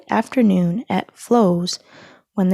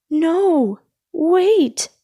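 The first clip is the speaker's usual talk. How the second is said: high, loud panicked scream; yelping before the jolt; 9 semitones higher, 5 dB louder, much slower than usual. A narrator's reading. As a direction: cry out as the shock hits. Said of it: "screamed Lightning".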